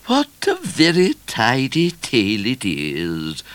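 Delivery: high, slow royal remark; regal Queen. A narrator's reading. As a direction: pass judgement as a stately queen. Queen remarks on his story.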